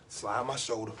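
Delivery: normal talking voice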